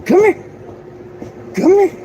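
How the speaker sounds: very high sing-song calling the dog; high dog talk. Real, not acted. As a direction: coax the pup over in a squeaky high voice.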